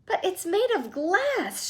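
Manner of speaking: high, slow, panicky protest; alarmed shout. A narrator's reading. Grandpa Joe protesting to Mr Wonka, high panicky shout. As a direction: cry out in dread. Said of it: "shouted Grandpa Joe".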